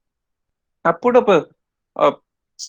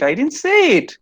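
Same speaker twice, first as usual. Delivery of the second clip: "say" lifted high above the rest; stress on SAY; 10 semitones higher than usual. Shown as a demonstration. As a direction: rise sharply on "say" and fall. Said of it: "but it's understood".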